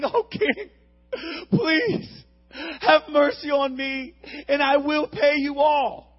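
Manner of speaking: high, loud begging cry; desperate plea. Acted. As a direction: beg the king for mercy, voice breaking. Servant begs king for mercy.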